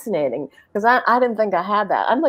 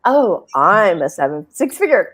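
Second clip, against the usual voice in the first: mock proud boast; 8 dB louder than usual. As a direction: puff up like a proud winner. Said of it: "you talked about the label".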